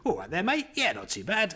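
overdone film-dub Cockney; mock Cockney. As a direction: lampoon dubbed Cockney peasants in a Cockney accent. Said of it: "French peasants going".

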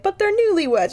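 hammed-up innocent son to his mom; mock pleading son. Son pleads with his angry mother, high mock whine. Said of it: "And then he's like".

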